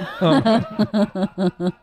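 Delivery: high cackling laugh; burst of high laughter. Real, not acted. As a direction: let a real laugh burst out.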